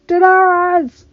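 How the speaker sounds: high, very loud call; long, full-force yell; yelling across distance. Acted. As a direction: shout the name across a great void. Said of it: "Jon yelled across the void".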